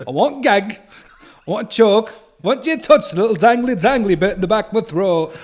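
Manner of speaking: high, loud hammed-up Yorkshire accent; mock Yorkshire rapper. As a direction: rap it as a Yorkshire girl, overdone. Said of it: "I actually have a lethal Yorkshire accent too".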